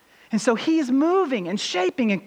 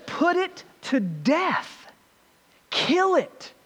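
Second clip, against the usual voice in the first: high, drawn-out emphatic quoting; slow, deliberate quote; 5 semitones higher, much slower than usual. Retold or imitated. Preacher quoting the apostle's command to his congregation, slow and emphatic. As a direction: stretch each word to drive it home. Preacher drives home the key verse.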